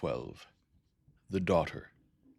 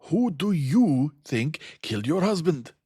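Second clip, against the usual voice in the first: slow, loud and much higher; loud emphatic question; 12 semitones higher, 11 dB louder, slower than usual. A narrator's reading. Poirot presses Jane about her husband, loud and slow. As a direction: hammer each word home. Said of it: "in a loud, emphatic voice".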